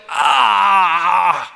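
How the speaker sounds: long, held "ahh" moan; acted moan of someone overwhelmed by problems; wordless moan. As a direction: let out a drawn-out groan in place of a prayer. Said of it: "A moan and an amen".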